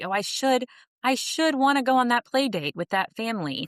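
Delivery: mock guilt-trip inner voice; mock should story. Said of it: "making up a should story".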